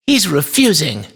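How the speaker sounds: put-on high child's voice; complaining boy. A narrator's reading. Boy tells father the toy refuses, complaining. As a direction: pitch it up as a little boy.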